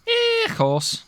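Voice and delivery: high Scouse "yeah, of course"; Scouse accent. Acted. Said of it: "Scouse accent".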